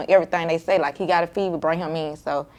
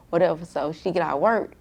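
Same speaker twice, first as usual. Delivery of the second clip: ordinary talk, nothing marked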